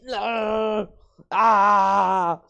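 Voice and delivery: high, loud metal "aah"; metal-style yell. Shown as a demonstration. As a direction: cry out like a metal singer. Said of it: "change to metal".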